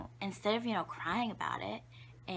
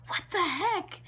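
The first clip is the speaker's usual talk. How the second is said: high, louder cry of disbelief; astounded mom; 10 semitones higher, 5 dB louder than usual. Retold or imitated. Mom facing her mess-covered baby, astounded. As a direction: imitate a baffled, appalled mother. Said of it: "she's astounded".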